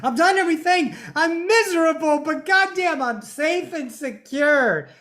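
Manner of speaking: high, loud hammed-up whine of giving in; mock resigned conformist. Imitating the safe, miserable conformist. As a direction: whine it out like someone who gave up.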